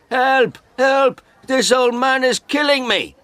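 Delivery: very high, panicked yell; shouting for help. A narrator's reading. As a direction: scream for rescue at the top of the voice. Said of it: "He shouted".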